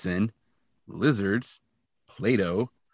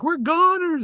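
high, loud mock-panicked wail; Shaggy; 5 dB louder, slower than usual. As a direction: cry out in cartoon despair. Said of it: "And Shaggy's like".

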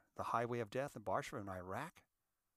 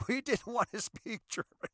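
whispered through a laugh, much higher; 10 semitones higher, 7 dB louder than usual. Pretend. Speaker repeats the joke to the crowd as a giggling whisper. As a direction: breathe it out as a giggling aside.

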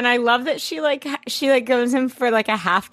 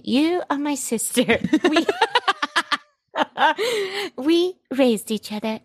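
lightly voiced sincere friend, laughing; 4 semitones higher than usual. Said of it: "Esther's like".

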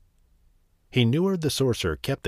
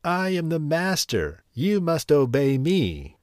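gleeful cry; 6 semitones higher than usual. Acted. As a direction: gloat like a new master. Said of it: "he cried".

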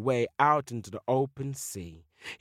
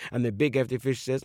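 usual speaking voice

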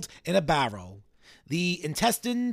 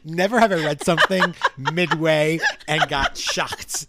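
laughing in disbelief; 5 dB louder than usual. Real, not acted. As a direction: talk through shocked laughter. Laughing after shock.

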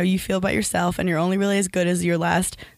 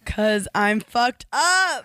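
slow deadpan; 4 semitones higher, much slower than usual. Real, not acted. Self-deprecating punchline, slow.

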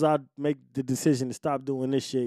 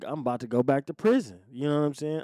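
normal talking voice

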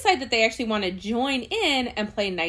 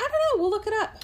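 squeaky, much higher voice; 13 semitones higher, much faster than usual. Real, not acted. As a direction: pitch it way up playfully. Squeaky high response.

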